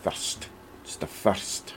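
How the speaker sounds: a quiet attempt at a Scottish accent. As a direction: copy his Scottish mate's accent. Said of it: "My Scottish accent is getting much better".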